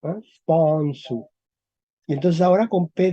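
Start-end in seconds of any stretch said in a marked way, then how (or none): 0.3-1.3 "Sponsu" with a drawn-out open O; "Sponsu", lingering on its open vowel. Shown as a demonstration. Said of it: "es una O abierta".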